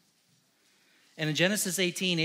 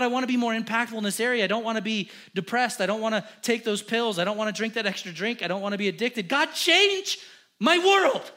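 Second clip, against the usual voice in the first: building from loud to a much higher, louder cry; desperate prayer; 6 semitones higher, 6 dB louder than usual. Acted. Believer begs God to change their world, crying out. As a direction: cry out to God, climbing to a shout.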